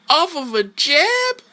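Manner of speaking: very high, slow incredulous repeat; stunned disbelief. Real, not acted. Player stunned the opponent reacted, high slow incredulous repeat. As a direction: repeat jab in real disbelief.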